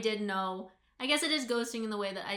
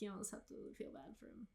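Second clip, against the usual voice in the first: whispered aside; 16 dB quieter, faster than usual. Real, not acted. Soft sympathetic aside.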